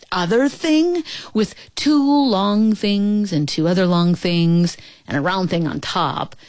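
ordinary delivery, nothing marked

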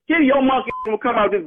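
ordinary talk, nothing marked

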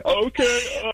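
high timid okay; imitating his scared self. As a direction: squeak it out, terrified. Scared teen reply.

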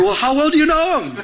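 high-pitched suspicious grilling; mock jealousy. Jealous fiancé grilling her about the other man, mock jealousy. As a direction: play the jealous interrogator, comically indignant. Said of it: "so upset and so jealous".

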